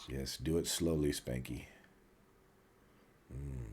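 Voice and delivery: low, hushed teasing; mock seductive. Friend teasing another listing cookie ingredients, mock-seductive murmur. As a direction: play at savoring it, soft and low.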